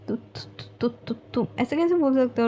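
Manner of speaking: flustered blushing. A narrator's reading. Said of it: "पूरा की पूरा ब्लश कर जाती है".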